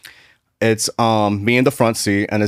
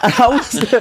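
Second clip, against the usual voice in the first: laughing; 11 semitones higher than usual. Real, not acted. Laughing after the Elmo bit.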